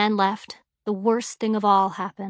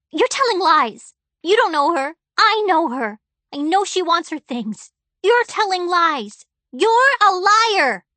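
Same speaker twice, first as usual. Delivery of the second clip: high, loud, tearful rage; girl accusing her father of lying, furious; angry crying accusation; 8 semitones higher, 6 dB louder than usual. A narrator's reading. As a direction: hurl it at him sobbing.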